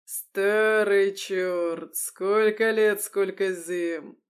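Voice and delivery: loud, very slow hearty bellow; roaring bass greeting. A narrator's reading. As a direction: boom it out to an old friend, stretching the words. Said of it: "заревел гость басом".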